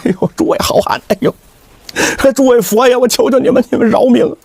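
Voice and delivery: pleading with a tearful edge; 带哭腔求饶. A narrator's reading. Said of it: "都带哭腔了". (带着哭腔哀求)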